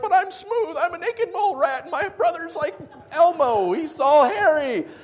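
high, loud mock complaint; protesting son. Acting out Jacob's protest.

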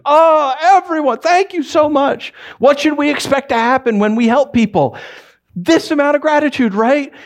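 hammed-up grateful gushing; gushing thanks. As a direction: gush like someone overwhelmed with thanks. Mock gushing gratitude.

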